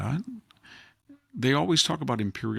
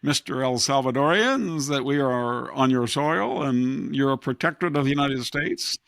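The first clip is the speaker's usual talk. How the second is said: 1 pompous imperialist; 2 semitones higher than usual. Retold or imitated. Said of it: "and they say".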